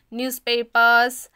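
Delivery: ordinary talk, nothing marked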